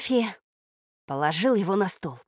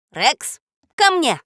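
high, loud summons; commanding call; 6 semitones higher, 6 dB louder, slower than usual. A narrator's reading. Little man summons the dog, commanding. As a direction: call the dog over sharply.

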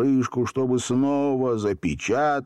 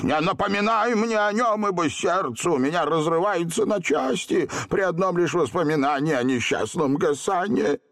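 high, wailing lament; grieving plea; 8 semitones higher than usual. A narrator's reading. Genie heartbroken over his brother, grieving. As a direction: beg not to be reminded.

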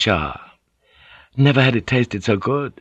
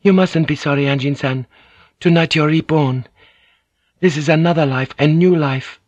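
higher, proud and honoring; proudly; 6 semitones higher than usual. A narrator's reading. Mariko tells the reborn Anjin-san he is honored, proud and higher. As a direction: lift him up with pride in him. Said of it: "Mariko said proudly".

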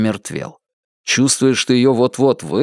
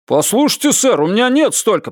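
high, hurried protest; high-voiced shopkeeper making excuses; pleading proprietor; 10 semitones higher than usual. A narrator's reading. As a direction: plead helplessly in a raised voice. Proprietor pleads with threatening customer.

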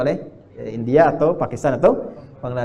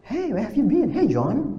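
a light native-sounding English, pitched up; native English accent; 6 semitones higher, slower than usual. Retold or imitated. Preacher imitating Indonesians speaking native English. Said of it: "Gitu kan?".

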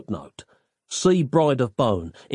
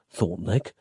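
ordinary delivery, nothing marked

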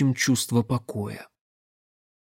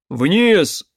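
short, raised, urgent command; shouted warning; 5 semitones higher, 5 dB louder than usual. A narrator's reading. Mage warns the girl before the shot, shouting. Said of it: "крикнул маг".

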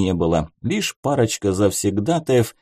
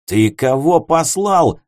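angry; 5 semitones higher, 4 dB louder, slower than usual. Acted. Grabbing him by the collar.

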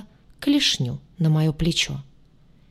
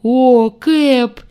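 higher and louder, booming joy; cheerful bass greeting; 5 semitones higher, 8 dB louder than usual. A narrator's reading. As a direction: boom it out gleefully. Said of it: "жизнерадостно пробасил демон".

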